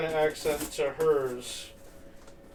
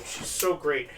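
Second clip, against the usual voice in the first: normal talking voice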